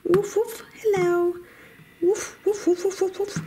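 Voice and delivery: high yappy puppy barks and a hello; squeaky little dog greeting friends. Pretend. Toy dogs greeting the viewers in high puppy voices.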